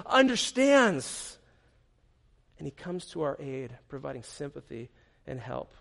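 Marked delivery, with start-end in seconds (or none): 2.6-5.7 soft, lower, gentle; hushed. Real, not acted. Pastor reassuring the congregation, soft and hushed. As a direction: pull right back to a hush. Sudden drop after climax.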